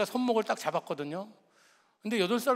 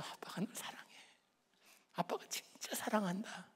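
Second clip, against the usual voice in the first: whispered blessing; 13 dB quieter than usual. Retold or imitated. Father laying hands on each child, whispering love. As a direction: whisper it close to the child's ear.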